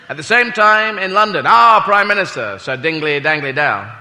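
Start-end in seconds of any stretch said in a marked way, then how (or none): none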